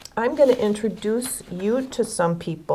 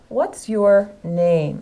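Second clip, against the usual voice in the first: slow question, voice falling on "name"; falling question tune; much slower than usual. Shown as a demonstration. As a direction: let the pitch drop down at the end on "name". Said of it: "your voice goes down at the end".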